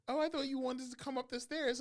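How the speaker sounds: high loud imitation of the old driver; high mock-innocent old woman; mock old lady. Mimicking old woman's reply.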